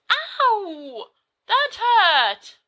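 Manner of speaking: high yelp of pain then a complaint. Acted. Friend gets smacked for interrupting, yelps in pain. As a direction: yelp as if someone just smacked you.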